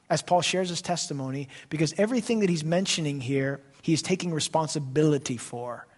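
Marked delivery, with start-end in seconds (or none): none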